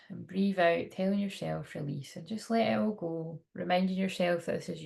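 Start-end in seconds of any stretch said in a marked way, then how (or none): 1.7-2.1 soft spa whisper. Acted. Inner mantra in meditation.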